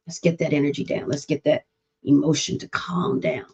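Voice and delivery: whispered, calming hush; soft calming whisper. Shown as a demonstration. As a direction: hush him down gently to calm the energy. Calming the child down.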